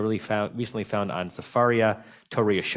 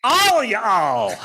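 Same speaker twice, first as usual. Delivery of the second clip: tipsy uncle; 13 semitones higher, 8 dB louder than usual. Retold or imitated. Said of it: "And he goes".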